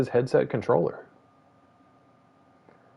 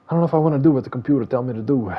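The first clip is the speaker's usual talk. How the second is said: faster, louder, put-on voice; fast mock voice; 4 semitones higher, 5 dB louder, faster than usual. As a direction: rattle it off in a silly voice. Fast far voice.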